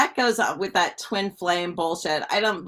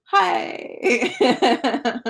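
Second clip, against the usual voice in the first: laughing hi; 7 semitones higher, 3 dB louder than usual. Retold or imitated. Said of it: "And you're like".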